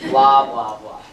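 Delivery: teen rolling eyes at parents' rules, mocking; mocking blah blah.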